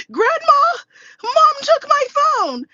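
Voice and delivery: high, loud, drawn-out mock wail; mock crying. Son telling on his mother to Grandma, mock crying. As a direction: imitate a tattling child's tearful cry. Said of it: "then he starts crying".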